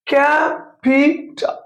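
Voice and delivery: ক্যাপিটাল slowly as ক্যা-পি-টাল; ক্যাপিটাল stretched into three syllables; split into syllables. Shown as a demonstration. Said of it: "এই যে alphabet তিনবার".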